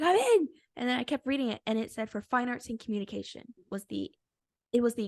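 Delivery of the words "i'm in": very high, loud exclamation; acted elation. Telling friend of getting in, elated shout. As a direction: re-enact yelling out great news.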